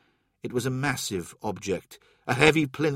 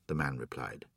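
plain reading voice

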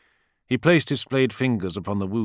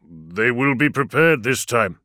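slow, louder grave warning; 3 semitones higher, 5 dB louder, slower than usual. A narrator's reading. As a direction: warn him gravely. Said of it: "Axata warned".

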